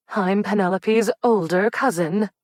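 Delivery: put-on a fussy older cousin asserting herself; prim older cousin. A narrator's reading. Cousin Cordelia voiced.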